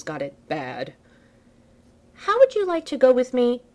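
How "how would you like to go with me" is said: high, louder playful invitation; bold invitation. Acted. Tony asking Steve out, playful and bold. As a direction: ask him out with a flourish.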